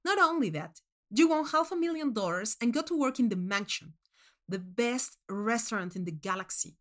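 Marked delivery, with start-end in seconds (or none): none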